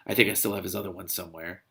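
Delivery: ordinary delivery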